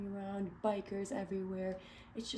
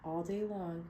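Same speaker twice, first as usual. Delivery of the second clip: clear, expressive read-aloud narration; storybook reading. Acted. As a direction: read it to a room of kids. Reading picture book aloud.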